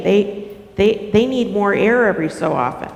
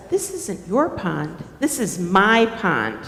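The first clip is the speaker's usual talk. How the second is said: lightly voiced beaver, lower in pitch; possessive beaver; 5 semitones lower, 5 dB quieter than usual. Beaver answers Turtle's claim to the pond, lightly possessive. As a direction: counter firmly with a lower voice. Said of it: "Beaver says".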